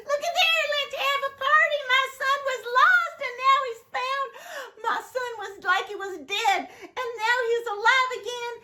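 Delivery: high, fast, jubilant; rejoicing father. Acted. Father celebrates his found son, high and fast. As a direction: cry out the good news.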